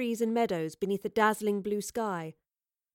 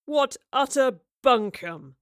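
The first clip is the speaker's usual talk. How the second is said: slow, loud, scornful outburst; loud contemptuous dismissal; derisive roar; 3 semitones higher, 6 dB louder, slower than usual. A narrator's reading. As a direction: roar it with derision, stretching each word. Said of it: "Rivers roared derisively".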